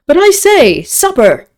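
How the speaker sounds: high, loud booming-in cheer; jovial lord. A narrator's reading. Hungry lord calling the inn to supper, jovial. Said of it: "here broke in Lord Antony's jovial voice".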